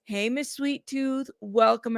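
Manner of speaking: ordinary delivery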